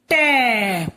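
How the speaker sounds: टै held long, gliding down; drawn-out syllable. Shown as a demonstration. Teacher showing ट with its matra, drawn-out टै. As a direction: stretch the vowel of टै. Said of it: "हम बोलेंगे".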